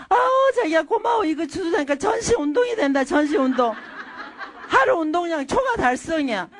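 high-pitched, gushing mock thanks; sweetly feigned gratitude. Wife picking up what her husband threw, gushing forced gratitude. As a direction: thank them over-effusively, hiding the irritation. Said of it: "저는 이쁜 반대말을 해요".

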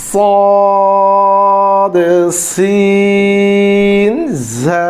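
সদ, সিন, ঝা chanted long on one steady note; drawn-out letter drill. Shown as a demonstration. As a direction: hold সদ thick, then সিন and ঝা thin, for the class. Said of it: "আবার". (সদ, সিন, ঝা একটানা সুরে লম্বা টেনে)

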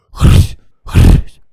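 loud crunching noise. Acted. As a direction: bite it off hard. Monster crunching attack. (гучний хрускіт)

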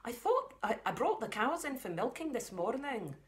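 a hint of a Scottish farm mum. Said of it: "the mum has got the Scottish voice".

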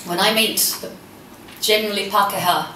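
ordinary talk, nothing marked

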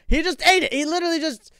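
high, loud yelp of protest; indignant high outburst; outraged disbelief. Real, not acted. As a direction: cry out in shock at the loss. Plant just eaten.

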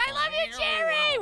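high, loud shout of affection; calling out. Real, not acted. Streamer signing off to Jerry, calling out. Calling out over raid noise.

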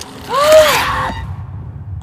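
sharp high frightened gasp; loud panicked gasp. Real, not acted. Player startled in game.

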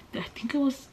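whispered aside to herself. Real, not acted. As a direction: drop to a breathy whisper. Repeats the phrase, then whispers it.

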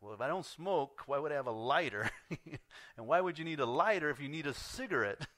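loud, high mock disbelief; incredulous. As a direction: play up the baffled inner objection. Incredulous thoughts at odd request.

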